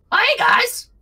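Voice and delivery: very high, excited little kobold voice; squeaky kobold Bug. Acted. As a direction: pitch way up like a tiny cheerful goblin. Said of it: "he looks at you all and goes".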